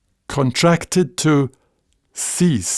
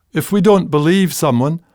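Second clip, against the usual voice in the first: ordinary talk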